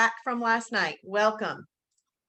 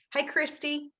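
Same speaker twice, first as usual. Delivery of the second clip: normal talking voice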